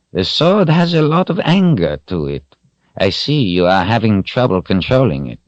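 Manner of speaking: put-on an old wizard reporting calmly; old wizard Zed. A narrator's reading. Zed tells Richard his friend is fine, calm old wizard voice.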